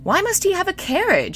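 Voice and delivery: high-pitched questioning; lightly acted plaintive query; wondering. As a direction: ask in puzzled exasperation. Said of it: "Shi Lian wondered".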